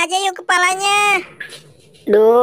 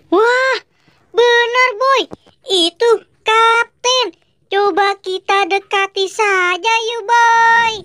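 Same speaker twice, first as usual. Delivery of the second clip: excited friend; 7 semitones higher, slower than usual. Acted. Friend tells Boy the captain is there, higher and excited. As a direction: point out the captain with eager delight. Second figure spots the captain.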